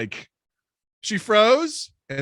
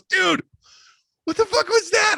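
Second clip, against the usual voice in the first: much higher, louder disbelieving squeal; stunned friend; 5 dB louder than usual. Retold or imitated. Friend shocked at narrator after the kiss, high squeal. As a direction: shriek it in shock like a buddy who can't believe it. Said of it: "My friend goes".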